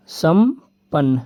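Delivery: संपन्न stretched very slowly, then विपन्न said normally; slow, drawn-out repeat. Shown as a demonstration. Teacher repeats संपन्न slowly for students. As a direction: spell out संपन्न slowly so the class hears it. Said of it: "एक होता है संपन्न".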